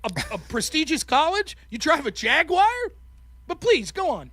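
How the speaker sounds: high-pitched mock-impressed questioning; sarcastic incredulity. Poor student mocking opponent's full ride, sarcastic. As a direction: feign being impressed, then invite them on.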